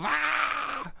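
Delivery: breathy high mock shriek; a quick whispered fake scream. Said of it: "they just go".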